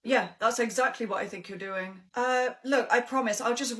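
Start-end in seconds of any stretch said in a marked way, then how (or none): none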